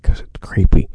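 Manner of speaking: loud stage whisper right on the mic; close-up, heavy whisper; creepy whisper. Pretend. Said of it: "you were really close to the microphone".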